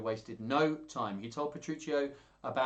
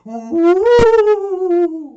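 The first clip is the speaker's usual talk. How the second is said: long, high, loud ghostly "woo"; drawn-out spooky wail; 23 dB louder than usual. Pretend. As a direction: hoot it out like a pantomime ghost. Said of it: "pretend to be a ghost".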